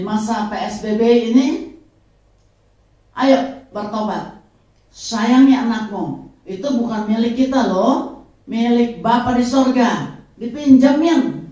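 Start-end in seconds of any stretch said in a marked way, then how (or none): 3.1-11.5 preaching exhortation. Real, not acted. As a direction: urge the viewers at full force. Closing exhortation to viewers. (khotbah berseru keras kepada penonton)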